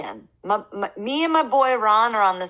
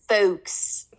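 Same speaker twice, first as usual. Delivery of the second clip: Philly accent. Shown as a demonstration. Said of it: "Unless you're from Philly".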